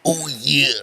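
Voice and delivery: lightly voiced wrestler catchphrase; Randy Savage. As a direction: imitate the wrestler, stretched out. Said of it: "talk like that on a normal basis".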